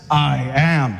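low, proud "I am" with a bit of swagger; a hint of a man standing tall, sure of himself; swaggering Jesus. A preacher voicing Jesus before the arresting crowd, lightly swaggering. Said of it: "He had the man swagger too".

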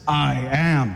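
low, with a touch of swagger; manly swagger. Acted. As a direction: say it with understated man swagger. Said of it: "He had the man swagger too".

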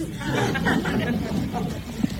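quiet amused laughter; soft laughter. Real, not acted. Amused by the broken-leg joke, soft laughter. Laugh after "they deserve it.

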